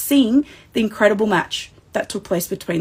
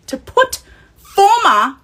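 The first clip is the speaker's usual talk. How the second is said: excited build-up; 10 semitones higher, 6 dB louder, slower than usual. Real, not acted. Building to a hyped moment.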